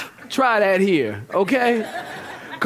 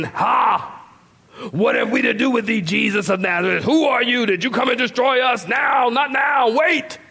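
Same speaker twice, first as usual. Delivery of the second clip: hammed-up demon, building higher and more forceful; demonic voice; 5 semitones higher than usual. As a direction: challenge them as a demon, rising to a shout. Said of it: "the demon is with this demonic voice".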